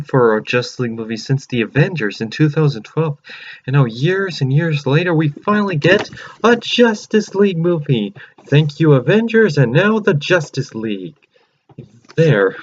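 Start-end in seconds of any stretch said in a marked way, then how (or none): 5.1-11.2 excited. Real, not acted. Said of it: "I'm so excited".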